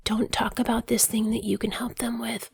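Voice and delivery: unvoiced hushed warning; mock whisper. Telling herself not to sell, whispered. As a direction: hiss it like a secret rule. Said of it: "hard for me to be like".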